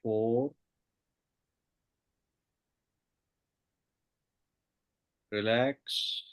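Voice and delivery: soft spa whisper. Acted. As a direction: lull them down with each count. Guided meditation countdown.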